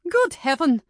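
a loud, high gasp of outrage; shocked exclamation. Acted. Lady Janet scandalised at the book, a loud shocked cry.

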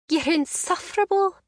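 playful mock outrage at her friend; jesting. A narrator's reading. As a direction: scold him in jest. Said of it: "she jested".

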